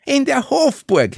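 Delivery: very high, thrilled cry; delighted exclamation. A narrator's reading. Club member thrilled by the palace venue, delighted exclamation. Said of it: "rief Anna Balic erfreut".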